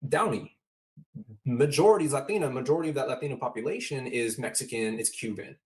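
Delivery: ordinary talk, nothing marked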